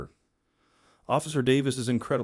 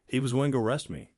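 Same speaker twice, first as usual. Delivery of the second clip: usual speaking voice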